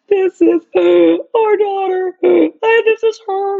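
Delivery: high, loud mock-crying with gulps; imitated sobbing. Partner holding little girl, imitated high sobbing. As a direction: act out tearful wailing, drawn out.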